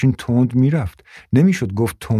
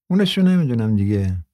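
ordinary delivery

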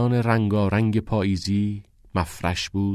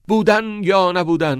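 higher, loud theatrical declaiming; dramatic recitation; 10 semitones higher, 5 dB louder than usual. A narrator's reading. Neil leaping off the wall, declaiming dramatically. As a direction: proclaim it like Hamlet. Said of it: "با یک شور و هیجان نمایشی".

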